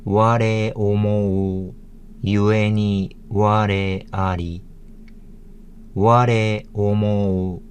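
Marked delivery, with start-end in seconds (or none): 0.0-4.6 slow, measured Japanese; slow Japanese reading. Shown as a demonstration. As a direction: read each syllable slowly.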